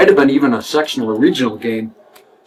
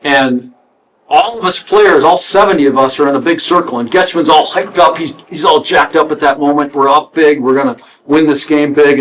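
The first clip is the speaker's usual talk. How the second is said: ordinary delivery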